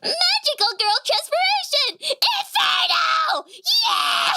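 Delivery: put-on very high, loud anime girl shout; bright magical girl. Shown as a demonstration. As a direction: shout it like a transforming heroine. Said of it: "a high-pitched girl voice".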